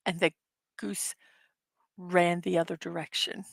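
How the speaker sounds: deliberate, hushed mock-epic telling; dramatic game narration. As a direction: narrate the chase solemnly, phrase by phrase. Plays out a goose adventure.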